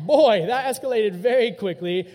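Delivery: loud laughing remark; real laughter, amused. Real, not acted. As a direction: laugh while saying it. Laughing at the escalation.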